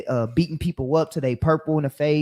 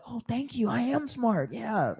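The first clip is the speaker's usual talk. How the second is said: lightly voiced, soft and pleased; a hint of a flattered yes-man; grateful Uncle Tom; 4 semitones higher, 5 dB quieter, slower than usual. Mocking an Uncle Tom who accepts the praise, soft and pleased. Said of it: "Uncle Tom Negroes, they love".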